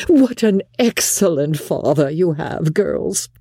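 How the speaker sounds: high, gushing matron voice; delighted mother. Acted. Mother praising father to her daughters, delighted. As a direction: praise him to the girls with high-pitched delight.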